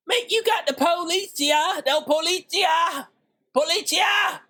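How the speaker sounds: policia rolled out high like a Spanish word; high mock-Spanish policia, like a cop show. Shown as a demonstration. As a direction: call out policia in a hammed-up Spanish accent. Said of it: "Isn't that how they say policia?".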